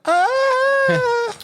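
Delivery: high breathy mock moan; hammed-up bedroom "Ah"; sex voice moan. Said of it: "is that your sex voice".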